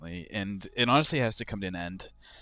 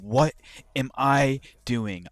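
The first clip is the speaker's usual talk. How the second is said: exasperated cry; 3 semitones higher, 5 dB louder, slower than usual. Real, not acted. Self-reproach after bad trade.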